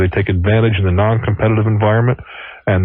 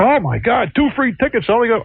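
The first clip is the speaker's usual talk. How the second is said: excited greedy passenger; 11 semitones higher than usual. Retold or imitated.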